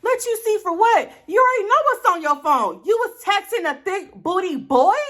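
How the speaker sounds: high, loud scornful challenge; accusing confrontation. Retold or imitated. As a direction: call him out, voice up high. Confronting boyfriend about messages.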